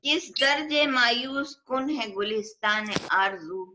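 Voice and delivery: poetry reading at a mushaira; ghazal recitation. Acted. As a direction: recite it as an Urdu couplet, lingering on the refrain.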